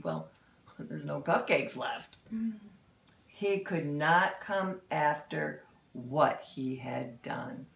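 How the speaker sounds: usual speaking voice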